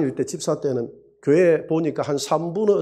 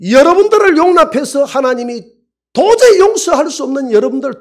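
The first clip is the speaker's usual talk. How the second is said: higher and louder, fervent sermon; preaching at full force; 8 semitones higher, 6 dB louder than usual. Acted. Pastor urging the church to accept each other, loud preaching. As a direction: drive it home to the congregation.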